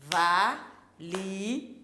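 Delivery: "valise" syllable by syllable, slowly; separado en sílabas. Shown as a demonstration. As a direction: break "valise" into three slow, spaced syllables. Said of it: "On sépare et on numérote".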